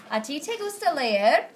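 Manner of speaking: rising question intonation on te; question tune. Shown as a demonstration. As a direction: ask it clearly as a question, voice rising. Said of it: "Question mark".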